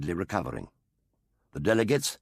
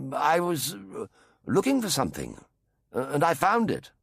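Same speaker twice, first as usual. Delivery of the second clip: nervous stammer; 7 semitones higher than usual. A narrator's reading. Jo caught, stammering excuse.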